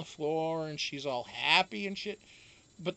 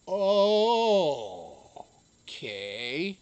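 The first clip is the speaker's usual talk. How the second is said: loud oh, then a small falling okay; dawning realization; 6 dB louder, much slower than usual. Retold or imitated. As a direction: let it dawn on you. Sudden realization on phone.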